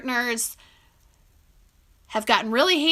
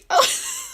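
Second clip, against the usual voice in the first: delighted squeal. Real, not acted. Dog wakes up, she squeals.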